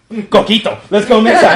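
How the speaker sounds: loud, high burst of delight; excited outburst. Retold or imitated. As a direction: cry it out like a brilliant idea just hit. Excitedly acting out a sudden idea.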